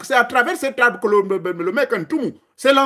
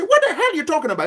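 fast, higher, baffled dog voice; confused dog; 7 semitones higher, faster than usual. Retold or imitated. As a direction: play the puzzled dog, quick and incredulous. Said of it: "the dog is gonna say".

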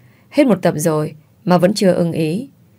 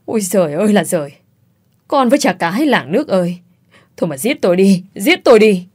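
melodramatic wailing. A narrator's reading. Mother learning of her son's gambling loss, mock-desperate wailing. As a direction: lament to the heavens, then burst out at the end.

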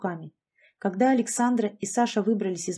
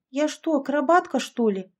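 high-pitched indignant grumble; grumbling complaint; 6 semitones higher, 3 dB louder than usual. A narrator's reading. Yashka objects to climbing on shoulders, grumbling indignantly. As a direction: protest it, pitched up. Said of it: "заворчала подруга".

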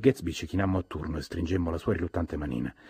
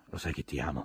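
murmured; 2 semitones lower, 5 dB quieter than usual. A narrator's reading. Daisy kissing Gatsby on the mouth, murmuring. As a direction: murmur it softly, close and private. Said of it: "mormorò".

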